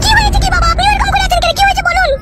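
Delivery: high, alarmed pleading; anxious questioning. Acted. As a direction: press anxiously for the news. Woman anxious at father's panic.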